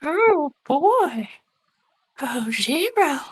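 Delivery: breathy, high, slow flirt; flirty character voice. Acted. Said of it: "when you go into voices".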